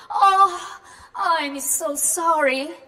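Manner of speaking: gasp, then apologize slowly; embarrassed apology. Acted. Marianne apologizing to Tom after insulting his party, abashed.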